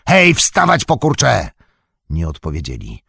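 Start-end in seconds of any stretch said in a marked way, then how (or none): 0.1-1.5 high, loud bullying shout; orc yell. A narrator's reading. Orc slave-driver to two figures by the cliff, yelling. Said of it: "i wrzasnął".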